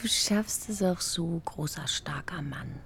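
soft unvoiced whisper, half teasing. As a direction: whisper it so as not to wake anyone. Partner whispering in bed at night. (geflüstert, leicht neckend)

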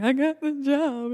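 high, loud mock crying voice; imitated tearful wail. Caller telling her friend the news, hammed-up crying. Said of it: "the first thing she hears is me crying".

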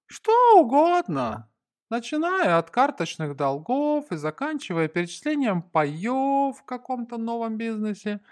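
high, musing character voice; thoughtful. A narrator's reading. Cuban guessing what the note means, high thoughtful musing. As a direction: muse aloud, stretching the first and last words. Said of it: "задумчиво отозвался кубинец".

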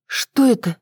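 повыше, с лёгкой тревогой; настороженно спроси, подняв голос; встревоженный вопрос. A narrator's reading. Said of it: "Я встревоженно огляделась". (тревожно, но сдержанно)

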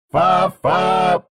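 honking car horn sound. Acted. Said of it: "сигнал, как у автомобиля".

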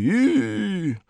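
high echoing syllables fading; fading echo effect; booming echo. A narrator's reading. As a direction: echo it down the halls. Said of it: "загремело эхо в здании".

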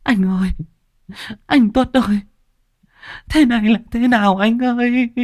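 high, anguished wail; wailing cry. A narrator's reading. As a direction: wail over the husband's body. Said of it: "chị gào lên".

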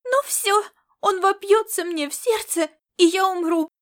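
put-on high, loud girlish wail; squealing. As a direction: squeal in hammed-up panic like a child. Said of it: "Девочка взвизгнула".

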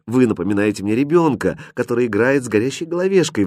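plain reading voice

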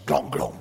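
deep gulping noises; low glugging sounds. Acted. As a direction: mimic two big gulps. Imitates gulping vodka.

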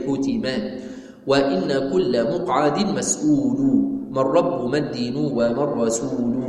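measured, drawn-out chanting of the poem; slow verse recitation. Acted. Teacher reciting the creed poem to students, slow chant. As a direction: give each line of the poem its full slow weight.

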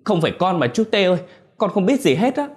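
high, fast pleading cry; desperate denial. A narrator's reading. Beaten boy protesting his innocence to the angry father, desperate high pleading. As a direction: beg to be believed, voice flying up.

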